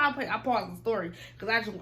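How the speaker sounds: normal talking voice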